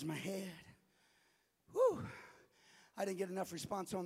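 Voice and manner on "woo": excited whoop. Real, not acted. Calls for crowd response.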